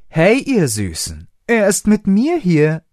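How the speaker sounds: slow, then high, coy purring come-on; flirtatious. A narrator's reading. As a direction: sweet-talk the bouncers. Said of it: "in verführerischer Pose". (verführerisch)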